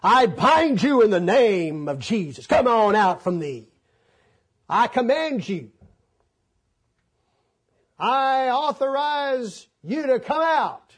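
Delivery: loud, pompous commanding voice; mocking a showy exorcism; mock exorcist. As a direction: ham up a self-important exorcist. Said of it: "go out and say".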